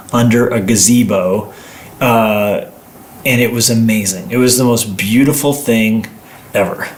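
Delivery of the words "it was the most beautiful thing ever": ordinary talk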